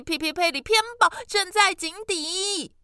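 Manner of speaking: 高声大喊; loud calling. Acted. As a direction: shout the names at full volume. 弟弟继续喊名字. (弟弟继续大喊哥哥的名字)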